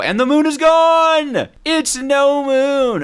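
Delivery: high, loud and drawn out in alarm; excited shout. Real, not acted. Gamer yelling to chat that the moon vanished, high and loud. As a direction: cry out that the moon is gone.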